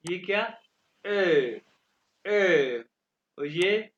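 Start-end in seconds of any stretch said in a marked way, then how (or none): 1.1-2.8 "ए" as long German ö with rounded lips; loud drawn-out "ए", rounded into ö; umlaut pronunciation demo. Shown as a demonstration. Teacher shows how O-umlaut sounds, long vowel demo. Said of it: "इनको pronounce कहते हैं, कैसे करते हैं".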